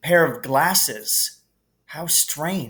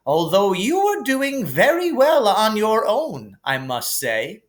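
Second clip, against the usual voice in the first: higher, gently amused headmaster voice; kindly old headmaster; 8 semitones higher, 4 dB louder than usual. Acted. Dumbledore calming Harry in the hospital wing, gently amused. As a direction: soothe the boy like a kindly wise old wizard.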